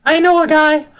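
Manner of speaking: high cartoonish troublemaker voice; squeaky excitable schemer; Damien voice. GM as Damien laughing.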